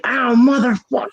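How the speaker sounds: high-pitched imitated yelp of pain; mock pained curse. Man who crashed into a cactus, mock pained cursing. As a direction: curse as if freshly hurt, hammed-up. Retelling his cry on crashing.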